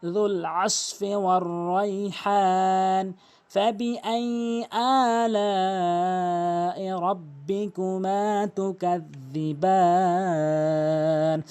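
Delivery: slow, drawn-out chanted recitation; Quran recitation. Shown as a demonstration. Tajweed teacher reciting the verse for students, slow and melodic. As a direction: recite it melodically, stretching every vowel.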